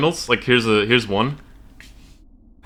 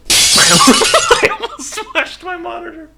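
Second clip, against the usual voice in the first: high, slow, laughing it out; 4 dB louder, slower than usual. Real, not acted. Streamer laughing after nearly smashing the monitor. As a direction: laugh through the words, much higher and louder.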